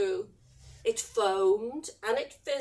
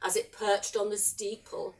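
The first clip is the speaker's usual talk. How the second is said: ordinary delivery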